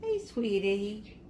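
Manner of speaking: soft slow words to a shy cat; soft soothing. Real, not acted. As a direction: soothe her gently.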